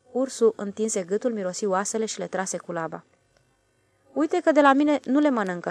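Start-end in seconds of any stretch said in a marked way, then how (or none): none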